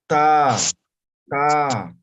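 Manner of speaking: "Ta" with tongue on the teeth, punched loud; tongue tip on the teeth, "ta"; dental ta. Shown as a demonstration. Teacher shows the dental "ta" to students, loud. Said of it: "Con los dientes".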